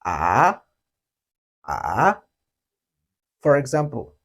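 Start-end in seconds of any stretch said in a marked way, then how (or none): 0.0-2.2 AH starting low, quick rise; small low dip on AH, straight up; correct third tone. Shown as a demonstration. Teacher shows the right way, low dipping-rising AH. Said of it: "a little and then rise up immediately".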